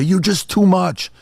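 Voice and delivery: put-on high, slow, accusing teen voice; a son pitched high and bitter, storming off; angry son. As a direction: throw it at your father like a resentful kid. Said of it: "they walk away and say".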